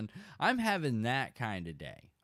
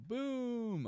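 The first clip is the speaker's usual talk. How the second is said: high boom effect; 11 semitones higher, 4 dB quieter than usual. Acted. Launching the boat, sound effect.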